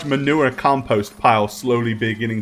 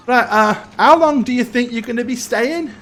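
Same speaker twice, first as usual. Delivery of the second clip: put-on high-pitched boy's voice; young stable boy; 11 semitones higher than usual. Stable boy asking guests about their cart, high boyish voice. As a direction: pitch it well up like an eager-to-serve lad. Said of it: "the boy goes and".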